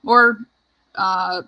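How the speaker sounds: usual speaking voice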